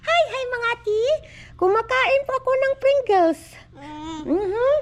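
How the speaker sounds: put-on high, loud little-kid greeting; child voice. Mother speaks for her toddler to viewers in a high child voice.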